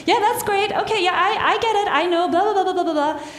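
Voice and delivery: high, overly agreeable fake okay; sing-song cover-up of hurt feelings; forced fine. Fake agreeing to cancelled plans.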